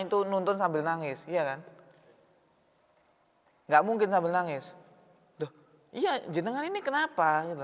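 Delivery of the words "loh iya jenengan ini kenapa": high-pitched startled question; a passerby startled by the old man's tears; surprised concern. Retold or imitated. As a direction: ask as if suddenly alarmed for him. Passerby presses the crying man.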